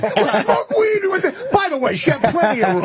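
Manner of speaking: laughing through the quote, pitch up; cracking up while repeating the line; laughing retelling. Retold or imitated. Laughing as he repeats the guy's line to the hosts. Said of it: "He said that?".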